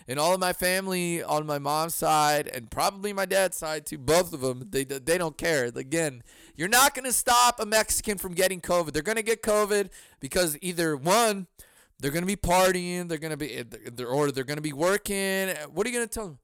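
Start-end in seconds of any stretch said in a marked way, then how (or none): none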